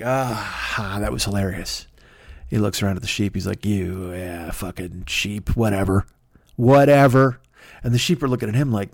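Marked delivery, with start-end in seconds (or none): 0.0-1.9 low, soft contented sigh; acted quiet, low musing; self-satisfied George. George sighs to himself. 3.6-7.4 acted bored grumble, pitched down; dismissive George. George eyes the sheep, bored.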